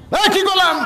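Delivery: high, sharp call for a response; calling out to crowd. Real, not acted. Calls out for audience response.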